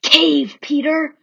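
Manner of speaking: loud urgent warning; warning call. A narrator's reading. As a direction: call a warning from far above. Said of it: "called out".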